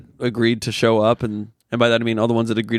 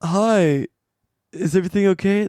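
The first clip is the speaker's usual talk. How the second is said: high anxious "Is everything okay?"; worried asking; 8 semitones higher than usual. Retold or imitated. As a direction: dread in the question. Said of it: "I'm like".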